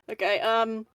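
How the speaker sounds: ordinary talk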